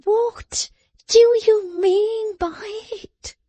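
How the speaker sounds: high whispered "what" then slow; the word "what" hissed high, rest slow; emphatic what. A narrator's reading. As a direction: lean hard on "what". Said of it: "recurring to the emphatic word".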